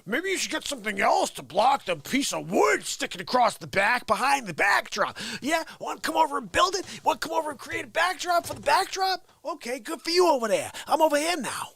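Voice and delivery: sarcastic critic voice; mocking critics. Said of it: "So I don't wanna hear".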